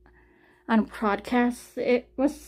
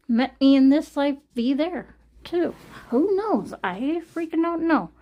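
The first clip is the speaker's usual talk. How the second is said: ordinary talk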